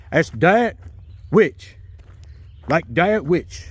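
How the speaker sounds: high voice, Dietrich said as "Dietwich", w for r; says w for r. Retold or imitated. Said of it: "he had a speech impediment. He couldn't say his R's".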